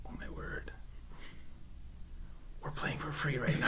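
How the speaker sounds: excited hushed whisper with a laugh. Retold or imitated. Realizing the arcade game is free, a giddy whisper. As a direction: whisper it like a secret discovery. Retold reaction discovering free mode.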